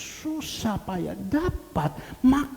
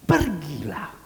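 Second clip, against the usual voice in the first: voice of Jesus; 4 semitones lower, slower than usual. Retold or imitated. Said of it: "dan berkata".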